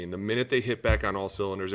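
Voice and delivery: normal talking voice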